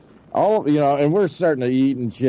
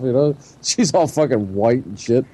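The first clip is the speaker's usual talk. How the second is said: ordinary talk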